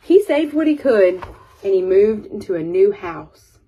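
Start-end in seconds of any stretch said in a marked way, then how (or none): none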